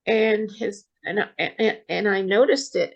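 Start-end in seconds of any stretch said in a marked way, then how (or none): none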